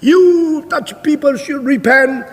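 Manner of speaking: high, slow, stretched-out preaching call; sermon interpreter. Retold or imitated. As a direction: imitate an interpreter echoing the preacher. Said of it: "Terus diterjemahkan".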